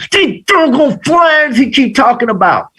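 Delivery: high-pitched exasperated whine, retold; frustrated.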